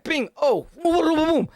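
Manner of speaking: punch sound effects. Retold or imitated. Boasting to viewers, he acts out punches as sound effects. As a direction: beat out the blows with your voice. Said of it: "I be like".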